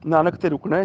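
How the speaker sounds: normal talking voice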